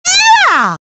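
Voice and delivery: very high, gleeful yell; jubilant cheer. Acted. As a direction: cheer at the top of the register. Character cheers.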